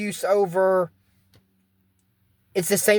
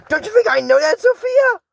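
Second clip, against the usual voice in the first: put-on higher, louder snapping back like a teenager; a bratty teen shouting at Sophia; mock angry teen; 6 dB louder than usual. Voicing Lonnie, angry teenager.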